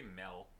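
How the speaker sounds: "milk" said as melk; accented vowel. Shown as a demonstration. Cohost owning up to their accent, "milk" said as melk. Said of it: "I say milk".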